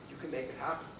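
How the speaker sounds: ordinary talk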